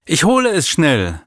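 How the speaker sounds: dash off eagerly. A narrator's reading. Maral jumps up to fetch.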